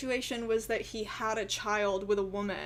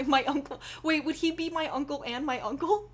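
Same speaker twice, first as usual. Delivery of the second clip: usual speaking voice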